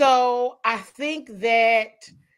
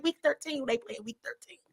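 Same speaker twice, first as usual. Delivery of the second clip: incredulous whisper; 9 dB quieter than usual. Retold or imitated. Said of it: "I'm like".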